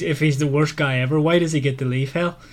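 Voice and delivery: normal talking voice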